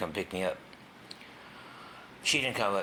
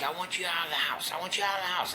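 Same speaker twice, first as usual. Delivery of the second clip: high, mock-furious stepmom voice; imitating the furious stepmom; angry stepmom; 11 semitones higher than usual. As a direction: throw him out, pitched way up. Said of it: "She's like".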